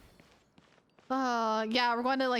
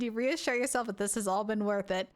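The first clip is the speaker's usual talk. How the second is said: ordinary delivery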